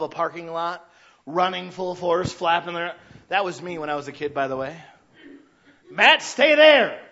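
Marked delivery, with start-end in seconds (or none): 5.9-7.0 put-on loud, slow warning call to a child; mom calling out. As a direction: call him back, drawn out and stern.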